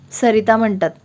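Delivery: ordinary delivery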